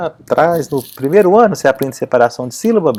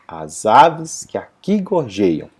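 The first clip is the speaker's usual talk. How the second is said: slow, lower "as aves que aqui gorjeiam", "que a" fused; declaimed scansion; 4 semitones lower, much slower than usual. Shown as a demonstration. Teacher repeating the declaimed verse, slow and lower with "que a" merged. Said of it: "quando a gente declama".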